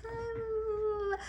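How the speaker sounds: long high soft coo; adoring baby squeal. Acted. You wanna talk to a baby, long high coo.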